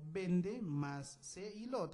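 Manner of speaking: Vende más se y lo slowly in separate pieces; part by part. Shown as a demonstration. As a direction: set Vende, se and lo apart, slowly. Teacher breaks word into parts.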